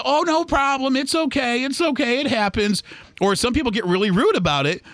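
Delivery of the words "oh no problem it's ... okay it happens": high, sugary "it's okay, it's okay"; forced polite reassurance. Retold or imitated. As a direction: swallow the pain and sound higher and sweet. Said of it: "And then you just go".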